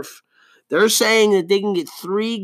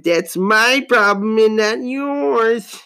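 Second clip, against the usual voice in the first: higher, slow put-on voice; 6 semitones higher, 4 dB louder, much slower than usual. Podcaster admitting gambling problem, slow put-on voice. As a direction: draw it out theatrically. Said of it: "I don't know why I'm talking like that".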